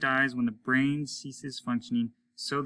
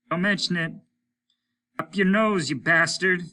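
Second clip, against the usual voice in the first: higher, louder gruff voice of Applegate; a rough spaceman calling in, raised voice; Applegate over the radio; 7 semitones higher, 7 dB louder than usual. A narrator's reading. Applegate calling Hollis over the radio, higher and louder.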